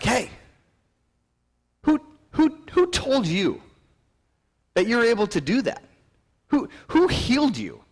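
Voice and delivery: high, loud, stammered accusation; indignant interrogation. Acted. Religious people questioning the healed man, indignant. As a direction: demand an answer, pitched high and loud.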